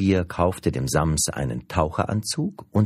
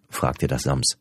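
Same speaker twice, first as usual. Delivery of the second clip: usual speaking voice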